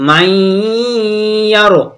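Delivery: "মাই" held long through the nose before "ইয়ারো"; nasal hold. Shown as a demonstration. Teacher showing the nasal reading, "মাই" held in the nose. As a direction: stretch the nasal sound at the end of "মাই". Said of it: "পরে আমরা নাকে আওয়াজ নেব".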